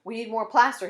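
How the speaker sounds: loud call to someone inside. A narrator's reading. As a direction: shout it to the next room. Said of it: "he called into the house".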